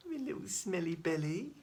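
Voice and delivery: high, sing-song pet talk; baby talk. Acted. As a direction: coo at the pet. Cooing at pet, repeated.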